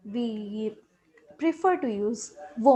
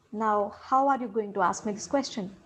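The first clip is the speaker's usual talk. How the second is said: normal talking voice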